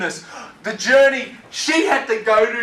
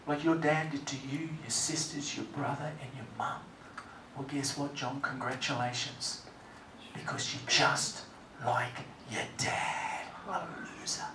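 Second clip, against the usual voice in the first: barely voiced, softer bitter whisper; almost unvoiced, bitter and hushed; 8 semitones lower, 19 dB quieter than usual. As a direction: whisper the cruel verdict to yourself. Bitter hushed accusation to himself.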